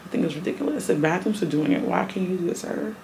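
usual speaking voice

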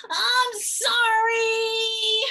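put-on high, loud, drawn-out yell of a resentful child; hammed-up angry child's sorry; mad, nasty apology. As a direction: spit out a forced apology, very slowly and loudly. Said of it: "and be really mad about it, nasty about it".

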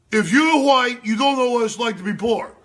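loud, high shouting parody; mocking Bernie Sanders. Host lampooning a Bernie quote with a high, loud shout. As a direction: bellow it like a ranting senator. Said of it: "Another quote from you know Bernie Sanders".

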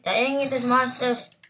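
ordinary delivery

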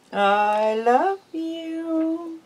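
"I love you" drawn out very slowly, high, leaning on "love"; slow drawn-out model. Shown as a demonstration. Owner teaching her pet bird the phrase, slow sing-song drawl. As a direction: stretch every word so the bird can copy it. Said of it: "Can you do it?".

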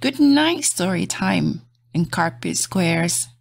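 gentle bedtime storybook read; reading to children. Acted. Reading a bedtime picture book aloud to kids, soft and gentle. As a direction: lull little listeners toward sleep.

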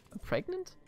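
can't believe the news; shocked question. Real, not acted.

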